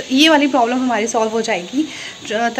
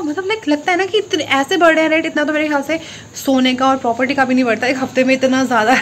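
ordinary talk, nothing marked

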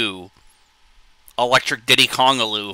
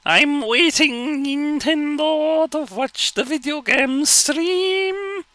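slow, very high drawn-out voice; mock patience in a stretched squeaky falsetto; falsetto character; much slower than usual. Impatient viewer waiting for the video game stream, slow falsetto.

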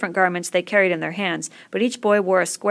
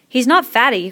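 loud, higher-pitched objection; raised voice, stressed protest; crying out; 5 semitones higher, 7 dB louder than usual. A narrator's reading. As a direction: cry out to correct the bully. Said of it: "cried Ralph".

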